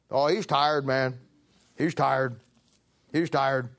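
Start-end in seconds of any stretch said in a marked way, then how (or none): none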